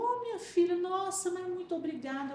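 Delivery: high, thankful voice of a church sister; grateful older woman. Retold or imitated. Church sister thanking the narrator, high and grateful. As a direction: sing out the thanks, pitched high.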